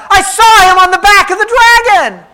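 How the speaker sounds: loud, high accusing cry. A narrator's reading. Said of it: "one woman cried out".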